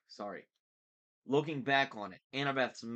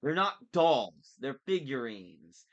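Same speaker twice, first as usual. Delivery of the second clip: indignant correction; 3 semitones higher, 7 dB louder than usual. A narrator's reading. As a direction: correct him indignantly, drawing out the words. Boy indignantly corrects villain.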